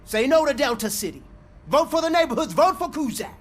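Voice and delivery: loud, high campaign chant; rally shout. Acted. Candidate shouting slogans to the crowd, loud rally call.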